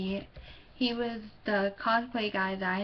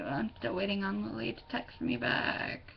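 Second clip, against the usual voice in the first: ordinary talk, nothing marked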